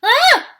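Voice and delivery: piercing high scream of fright. A narrator's reading. Franklin facing the dinosaur, screaming in fright. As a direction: let out a full terrified shriek. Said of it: "screamed Franklin".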